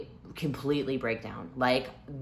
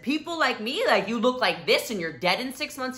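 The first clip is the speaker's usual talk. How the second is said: a little higher, mock-incredulous; disbelieving question; 7 semitones higher than usual. Patient challenging the doctor, lightly raised disbelief. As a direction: ask it in disbelief, voice lifted. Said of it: "And I said".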